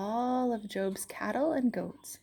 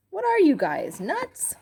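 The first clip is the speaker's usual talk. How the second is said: incredulous; 11 semitones higher, 6 dB louder than usual. Acted. Repeat of the same jab.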